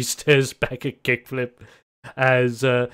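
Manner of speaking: ordinary talk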